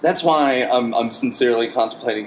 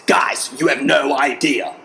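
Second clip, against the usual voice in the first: slow, put-on tough gym woman; crossfit woman impression; 2 semitones higher, slower than usual. Bartender imitating a crossfit woman at the bar, slow tough voice. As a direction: drag it out like a hardcore gym regular. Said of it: "she talked like this".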